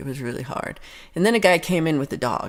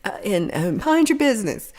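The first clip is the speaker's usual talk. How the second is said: high, urgent mock voice to herself; inner self-warning; 10 semitones higher than usual. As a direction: squeak the warning to keep your eyes down. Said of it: "I'm just like".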